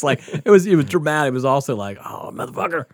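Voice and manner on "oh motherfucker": breathy grudging curse. Retold or imitated. Grudging admiration of prank.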